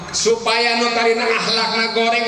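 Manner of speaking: ordinary delivery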